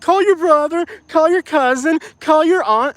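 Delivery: high imitation of his wife; Katie's voice. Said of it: "Katie's like".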